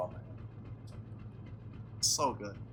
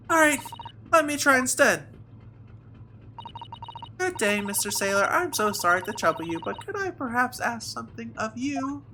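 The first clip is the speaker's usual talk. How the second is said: high voice, eager at first, then politely deferential; courteous assistant trying instead; 11 semitones higher than usual. Acted. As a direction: approach a stranger with careful courtesy.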